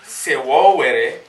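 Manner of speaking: Sewowere said slowly and loud, syllable by syllable; drawn out for the crowd to repeat; slow teaching pronunciation. Shown as a demonstration. Elder leading the crowd in a chant, slow call. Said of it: "call and repeat".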